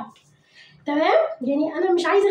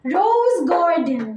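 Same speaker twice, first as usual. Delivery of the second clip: slow model word; much slower than usual. Shown as a demonstration. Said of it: "هتستفيد كتير لما تقول ورايا".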